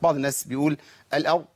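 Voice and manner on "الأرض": "الأرض" with ra swallowed into the Dad; lost ra. Retold or imitated. Teacher imitating some reciters' mistake, lost ra. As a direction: blur away the ra of "الأرض". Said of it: "ويضيع الراء مع الضاد".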